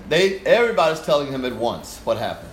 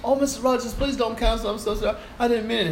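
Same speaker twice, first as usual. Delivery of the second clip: fawning conductor; 10 semitones higher than usual. Retold or imitated. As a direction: gush sweetly to win him over. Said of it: "he started fawning on me".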